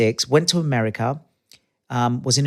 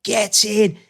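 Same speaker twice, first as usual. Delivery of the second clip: higher, louder football dad cheer; sideline cheer; 8 semitones higher, 3 dB louder than usual. Retold or imitated. Imitating dads cheering their sons at football, loud. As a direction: call it from the touchline like a keen dad. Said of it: "all the dads ... going".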